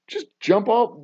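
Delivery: usual speaking voice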